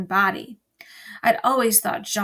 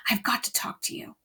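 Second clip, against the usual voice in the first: whispered, pressing; urgent whisper; 6 dB quieter than usual. A narrator's reading. Bryce pulling Julie aside, urgent whisper. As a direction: grab her and whisper it.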